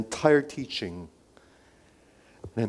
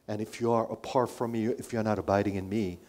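normal talking voice

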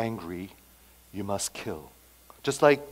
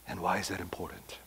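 whispered, quick repeat of the question; hushed aside; 5 semitones lower, 11 dB quieter, faster than usual. Acted. Preacher whispers the question back to the congregation. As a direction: drop to a whisper for the echo.

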